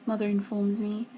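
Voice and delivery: ordinary delivery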